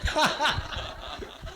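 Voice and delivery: breathy burst of laughter; a high, soft giggle; laughing. Real, not acted. Preacher giggling at the verse.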